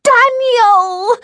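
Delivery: drawn-out, very high shriek; piercing child's scream, stretched out; little girl's shriek. A narrator's reading. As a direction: shriek your brother's name. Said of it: "went from yell right to shriek".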